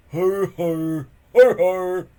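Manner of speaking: loud high snorting ho ho; snorting laugh. Acted. Dim sidekick snorting at the victims, loud laugh. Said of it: "snorted Dog's Breath".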